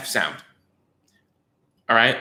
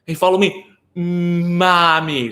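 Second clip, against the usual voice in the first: high, over-mouthed sing-song prompt; exaggerated therapist prompt; 5 semitones higher, 3 dB louder, slower than usual. Shown as a demonstration. Therapist demonstrating exaggerated mouth shapes to a child. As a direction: stretch your mouth shape wide like a drilling therapist. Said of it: "exaggerating your mouth shape like this".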